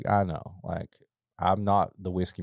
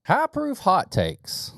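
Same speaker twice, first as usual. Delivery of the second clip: segment title call; 11 semitones higher, 4 dB louder, much slower than usual. Acted.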